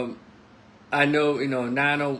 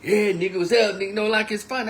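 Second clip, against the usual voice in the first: high, sing-song mockery of young folks; mock their careless slang, pitched up; mocking imitation; 7 semitones higher, 4 dB louder than usual. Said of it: "making, you know, mockery of it".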